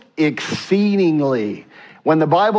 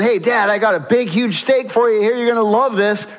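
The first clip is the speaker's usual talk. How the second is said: quicker, higher excited son voice; eager son; 6 semitones higher than usual. Acted. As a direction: pitch it up, eager to impress Dad. Son eagerly brings food to dad.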